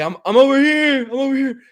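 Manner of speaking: high, loud call to the cops. Retold or imitated. Surrendering to the cops at night, high loud call. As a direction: shout to be found, hands up.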